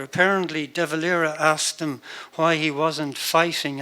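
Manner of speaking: ordinary talk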